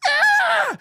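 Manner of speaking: high shrieking yelp; mock screech. Mocking the in-studio woman's screech, high shriek. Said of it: "Your dumb in-studio bitch went".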